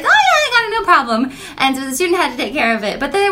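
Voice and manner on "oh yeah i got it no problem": high, breezy mock teenager; lightly voiced high schooler shrugging it off; casual teen. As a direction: toss it off easily, a bit higher and louder. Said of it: "they were like".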